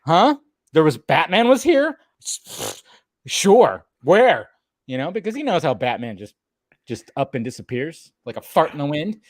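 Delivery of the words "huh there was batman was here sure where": higher-pitched mock innocence as the Commissioner; playing coy. Co-host imitating the Commissioner denying Batman was here, coy. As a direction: feign puzzled ignorance, pitched up. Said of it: "how Commissioner plays coy".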